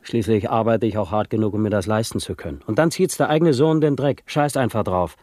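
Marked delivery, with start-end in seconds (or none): 2.7-5.2 higher and louder, outraged father; indignant father bursting out, higher and louder; indignant outburst. Acted. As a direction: spit out the disgust at the son. Father outraged at son quitting drugs.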